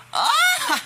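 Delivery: sudden very high shriek of offence; outraged shriek. Acted. Girl shrieking at her rival's insult. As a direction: shriek at the insult like you've been slapped. Catfight over a ballpen.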